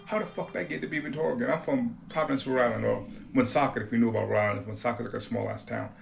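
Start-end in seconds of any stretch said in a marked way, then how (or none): 0.0-1.5 stunned disbelief. Retold or imitated. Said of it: "I'm like".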